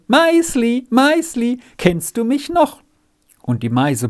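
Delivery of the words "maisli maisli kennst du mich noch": put-on boy tenderly greeting his old cow in the stall; tender call. A narrator's reading. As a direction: greet a dear animal with delight. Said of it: "rief ihr zärtlich zu".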